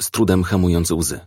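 ordinary delivery, nothing marked

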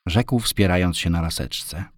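plain reading voice

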